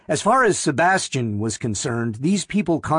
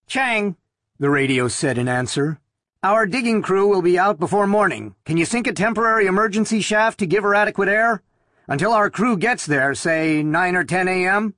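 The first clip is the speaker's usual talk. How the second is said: higher, brisk dispatcher over the radio; 6 semitones higher than usual. A narrator's reading. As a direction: rattle it off like a busy dispatcher.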